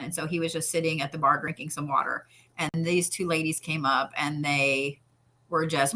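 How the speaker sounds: ordinary talk, nothing marked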